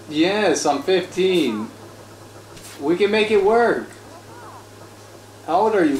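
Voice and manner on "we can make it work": pleading. Pretend. Pleading with game girl.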